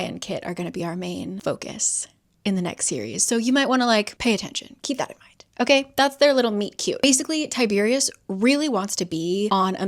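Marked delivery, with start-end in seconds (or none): 4.8-5.3 whispered tip to viewers; hushed aside. Real, not acted. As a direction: drop to a conspiratorial whisper. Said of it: "aside to viewers".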